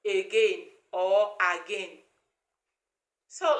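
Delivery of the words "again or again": "again or again" said slowly and low as the wrong forms; teacher demonstrating how not to say "again"; wrong pronunciations. Shown as a demonstration. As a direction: show the mistaken "again" variants, drawn out.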